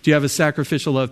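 fast, pressing repeat of the question; urgent pressing question. Shown as a demonstration. Pastor pressing Jesus' question on Peter, fast and urgent. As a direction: push the question hard and quickly. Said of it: "Peter, the one that just denied me three times, okay?".

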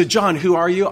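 religious leaders pressing John, sharp and demanding; demanding question. Acted. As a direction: put him on the spot. Preacher voices the leaders' question.

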